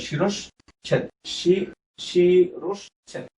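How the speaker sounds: শিরশ্ছেদ broken slowly into শি, রশ, ছেদ; syllable-by-syllable demonstration. Shown as a demonstration. Teacher sounding out শিরশ্ছেদ for students, slow and split up. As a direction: draw out শিরশ্ছেদ piece by piece with a sh sound. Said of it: "স এর মতো উচ্চারণ হয়".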